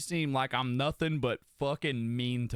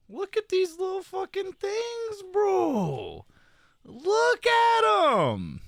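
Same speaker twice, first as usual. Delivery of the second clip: much higher, squealing over the photos; cutesy gushing voice; 8 dB louder, much slower than usual. Acted. Streamer shows chat kitten photos, squealing cutely. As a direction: gush like the pictures are too cute.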